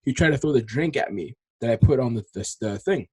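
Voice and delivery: ordinary delivery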